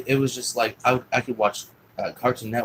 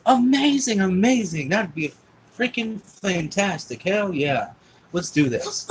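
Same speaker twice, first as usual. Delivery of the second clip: excited agreement; 8 semitones higher than usual. Retold or imitated. Woman told the kid will live with her, excited agreement. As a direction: imitate a woman thrilled by the news. Woman delighted to take him in.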